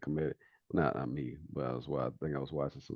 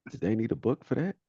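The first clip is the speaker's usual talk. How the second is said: much higher and louder, rising comic disbelief; mock incredulous question; 9 semitones higher, 7 dB louder than usual. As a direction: push the punchline up into an astonished question. Comedian's punchline turned into question.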